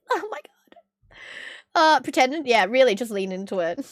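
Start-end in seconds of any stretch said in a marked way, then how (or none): 0.0-1.2 high, soft wheeze of laughter; laughed-out, voiceless exclamation; breathless laughter. Real, not acted. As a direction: let it out slowly while laughing. Laughing at her own advice.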